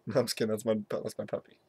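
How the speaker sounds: ordinary delivery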